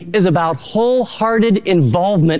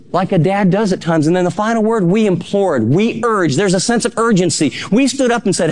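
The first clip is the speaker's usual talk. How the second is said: normal talking voice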